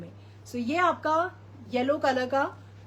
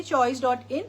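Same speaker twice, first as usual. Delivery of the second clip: ordinary talk, nothing marked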